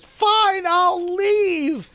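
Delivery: high put-on girl's voice; girl in tears leaving the table; tearful exit line. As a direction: squeak it out, wounded and proud. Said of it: "She goes".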